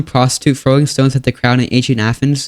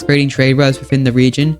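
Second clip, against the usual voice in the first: normal talking voice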